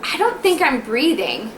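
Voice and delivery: slow, drawn-out drowsy worry, retold; waking-up worry. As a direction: act out groggily doubting your breathing. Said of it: "I kept waking up going".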